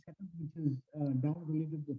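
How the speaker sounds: faint, quiet voice from far off; speaking too quietly to hear well; barely audible. Real, not acted. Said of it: "Your voice is very low".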